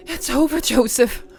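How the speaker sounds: high, loud victory cry; triumphant shout. Acted. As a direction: declare it over him, loud. Mary confronts her husband.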